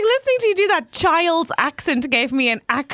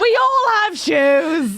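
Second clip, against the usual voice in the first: high, drawn-out indignant protest; mock outrage; 5 semitones higher, 3 dB louder, much slower than usual. Real, not acted. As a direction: stretch it out in comic indignation.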